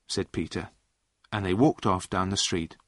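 ordinary delivery

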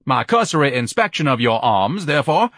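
grandiose, high-pitched orator; rally the workers pompously; lofty commander reviewing his troops; pompous Ignatius. Acted. Ignatius addresses his army.

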